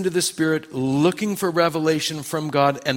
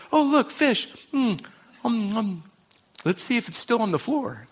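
higher-pitched, hammed-up casual musing; playful acting-out; 6 semitones higher than usual. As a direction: play the scene lightly, pitched up for comedy. Playfully acting out Jesus eating fish.

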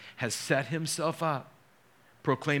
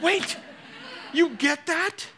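much higher, louder sudden double take; astonished; 3 dB louder, slower than usual. Retold or imitated. Father realizes his four-year-old grasped it, astonished. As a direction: stop short in amazement at her words.

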